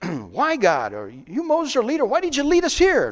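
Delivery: acted protest rising high and loud; murmuring complaint. People blaming Moses their leader, loud high complaint. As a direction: build into a loud, high accusation. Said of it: "No, they murmured".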